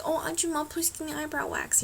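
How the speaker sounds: high-pitched, sweet-talking ask; polite request. Retold or imitated. Acting out her request at salon.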